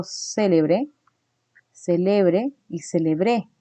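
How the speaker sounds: "célebre" on the first syllable, "celebre" on the middle, "celebré" on the last, slowly; stress contrast demo. Shown as a demonstration. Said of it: "hace la diferencia cuando digo".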